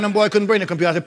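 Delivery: ordinary talk, nothing marked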